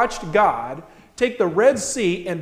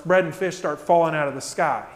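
ordinary delivery

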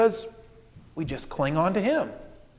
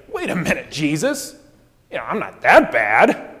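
mock indignant protest; 4 dB louder than usual. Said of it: "she doesn't say".

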